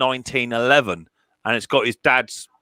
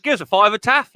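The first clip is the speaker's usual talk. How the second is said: higher, cheeky little-voice ask; imitating Riley asking; 8 semitones higher than usual. Riley asking Taff for money, mimicked higher. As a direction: pipe up like a kid angling for cash. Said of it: "So Riley went".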